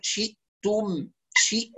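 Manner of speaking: "شئتم" with clear i, then a glottal stop; full i vowel. Shown as a demonstration. Teacher models for students, full i vowel. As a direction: separate "شئ" and "تم" with a catch. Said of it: "ونتم كسرة الشين".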